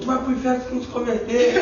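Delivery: frase mais alta e aguda, cheia de riso; citação rindo. Retold or imitated. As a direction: conta a ameaça rindo. Cita o irmão entre risos. (citação aguda entre risos)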